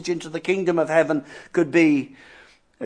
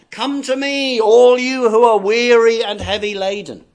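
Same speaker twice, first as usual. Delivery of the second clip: raised, ringing call to come; calling out invitation; 2 semitones higher, 6 dB louder than usual. Acted. Christ inviting the weary into the kingdom, calling out.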